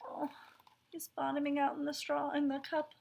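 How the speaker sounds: soft, sympathetic voice; softly pitying; sad sinking tone. A narrator's reading. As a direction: feel sorry for him as he sinks. Straw feels low, sinking.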